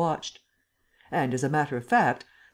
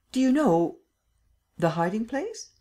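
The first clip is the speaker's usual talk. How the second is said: higher-pitched, eager imperial question; 7 semitones higher than usual. A narrator's reading. The Emperor questions Lupin about the hiding place, eager questioning. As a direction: press for the answer, pitch lifted.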